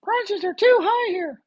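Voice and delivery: a guy griping about house prices, in a mocking high whine; mocking complainer. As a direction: mimic the doubter in a silly high whine. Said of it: "This guy".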